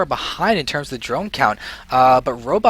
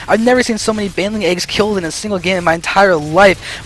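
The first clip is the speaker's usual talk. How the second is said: usual speaking voice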